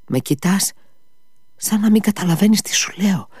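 rising, anxious and urgent; alarmed exclamation. A narrator's reading. Alyosha alarmed at his brother's sickly look, rising urgent voice. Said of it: "αναφώνησε ξαφνικά".

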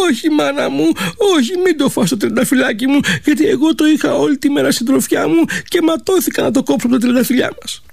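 high, pleading pretend sob to her mother; girl faking tears to save the rose; fake crying plea. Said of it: "τάχα μου η κόρη τα κλάματα".

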